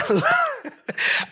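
a sudden high-pitched laugh breaking the line. Real, not acted. Preacher laughs mid-quote.

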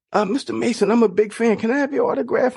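put-on high, timid young fan asking; a kid asking a star, high and nervous.

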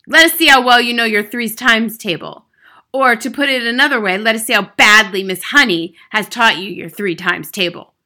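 loud, overbearing headmistress; big, imperious voice; booming. A narrator's reading. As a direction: boom a challenge at the class. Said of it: "boomed the Trunchbull".

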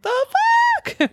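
very high, stretched imitation of disbelief; mock shocked outcry. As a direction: squeal it like a startled onlooker. Acting out startled fishermen seeing it stand.

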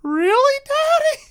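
put-on high-pitched little boy; very high child voice, questioning; young son's voice. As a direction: squeak it up like an eager kid. Young son questioning his father.

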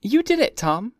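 high, loud accusation; accusing. A narrator's reading. Daisy blaming Tom for her bruise, loud and high. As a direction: point the blame right at him. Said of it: "she said accusingly".

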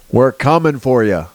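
ordinary talk, nothing marked

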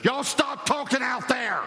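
high, slow shout across the room; mother yelling at kids. Retold or imitated. Single mother yelling at her kids outside the door. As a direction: yell it to the kids in the next room. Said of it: "And then she did this".

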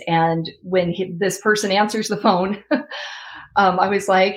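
ordinary talk

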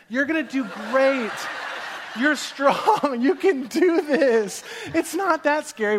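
high, loud mock encouragement; hammed-up pep talk to a child; cheering her on. Strangers talking up a scared child, overdone cheering. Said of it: "they're cheering her on".

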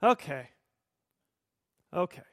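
resigned, offhand concession; mildly resigned acknowledgement; shrugging acceptance. Acted. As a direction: let it go with a verbal shrug. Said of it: "just shrug and say".

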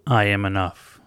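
loud, declared in one breath; booming self-affirmation. Shown as a demonstration. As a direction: proclaim "I am enough." loudly as one phrase. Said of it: "the louder you say it".

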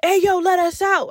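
lightly put-on macho dude calling out; acting macho. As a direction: imitate a macho guy demanding out. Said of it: "he started acting all macho".

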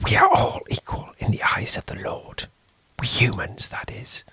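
fearful hushed question; whispered question. A narrator's reading. Priest whispering to the stranger about ghosts. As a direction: ask it under your breath.

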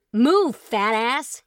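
loud, slow angry yell. Acted. Truman yelling at his brother Chet, loud and slow. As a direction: yell it as you throw the shoe. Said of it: "he yelled".

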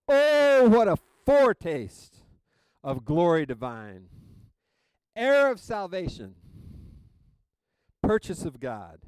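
loud, high, exultant hymn reading; big ringing opening, booming declaration; emphatic exclamation. Shown as a demonstration. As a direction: proclaim each phrase with an exclamation point. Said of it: "I'm an exclamation point kind of guy".

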